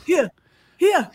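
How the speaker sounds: put-on teasing echo of the southern African accent; mock southern African accent. Friend echoes her audition word, mock southern African accent. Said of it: "is that DiCaprio in Blood Diamond".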